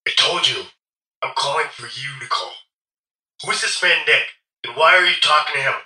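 low, menacing male caller; short, clipped commanding. A narrator's reading. Possessive caller confronting the woman, short and commanding. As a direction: order her about in a deep phone voice. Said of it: "His words are short and clipped".

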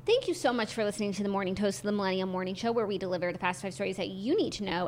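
brisk podcast sign-off, read fast; show outro read. Acted. Cohost reads the show outro to listeners, brisk sign-off. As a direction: read the wrap-up like a host.

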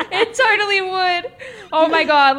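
high, loud, breaking into laughter; can barely get words out for laughing; laughing hard. Real, not acted. Reacting to the absurd storyline, laughing hard.

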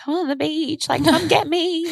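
high, whiny plea to be rescued; high-pitched, helpless mock plea; retold pleading. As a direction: plead like a stranded kid calling mum. Retold pleading call to mum.

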